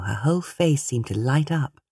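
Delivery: plain reading voice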